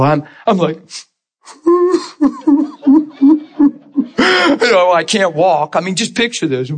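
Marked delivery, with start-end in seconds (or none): 1.6-3.7 high, hammed-up sobs; mock boo-hooing in short bursts; imitated crying. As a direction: act out pitiful weeping. Imitates his own crying.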